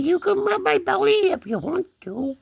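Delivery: high put-on kitty talk; cat voice. Cat inviting a belly rub, high cat voice. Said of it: "He says".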